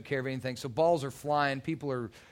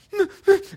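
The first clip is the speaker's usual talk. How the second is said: imitated sob; 10 dB louder than usual.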